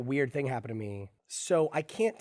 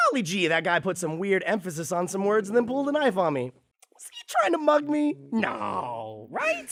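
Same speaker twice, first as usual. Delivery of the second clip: naive sing-song; 6 semitones higher than usual. Retold or imitated. Naive inner monologue.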